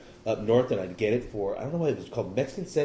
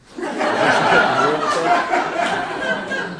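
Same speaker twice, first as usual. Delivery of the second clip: a big airy laugh burst; loud breathy laugh; 4 semitones higher, 10 dB louder than usual. Real, not acted. Laughing at his own old excuse, breathy and loud. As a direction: let a loud wheezing laugh out.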